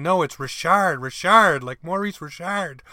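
Richard said the French way, ree-SHARD, punched; insistent French pronunciation. Retold or imitated. Parents correcting the announcer on their son's name, insistent. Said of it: "are blowing me up saying".

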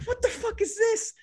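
high-pitched incredulous reaction; retold disbelief. Student spotting classmates boxing in class, retold high disbelief. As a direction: squeal it in mock bewilderment.

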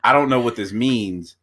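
ordinary delivery

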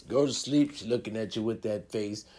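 normal talking voice

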